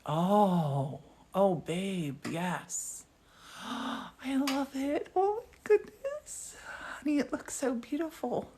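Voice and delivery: gasping, building into high, drawn-out wonder; delighted awe. Real, not acted. Wife admiring her husband's glass twist, delighted awe.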